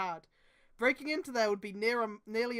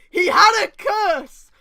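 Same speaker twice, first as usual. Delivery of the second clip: slow, much higher and louder, hammed-up gasp of drama; overacted doom announcement; mock dramatic; 7 semitones higher, 14 dB louder, slower than usual. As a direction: declaim it like a horror trailer. Overdramatic fanfic reading.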